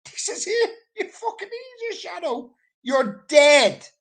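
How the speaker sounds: inner voice. Retold or imitated. Inner voice while drowning.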